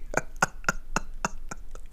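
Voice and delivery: soft, breathless laughing fit; wheezing laughter. Real, not acted. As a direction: laugh until the voice gives out. Cracking up at chimley.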